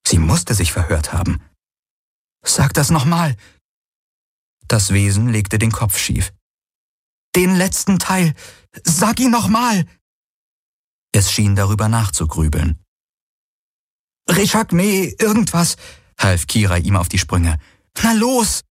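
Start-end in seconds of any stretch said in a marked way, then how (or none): 2.4-9.8 high, urgent pleading; desperate to hear it; urgent demand. A narrator's reading. She urgently demands the name. 14.3-18.6 high, eager prompting; urgent prompting. A narrator's reading. Urging the creature on.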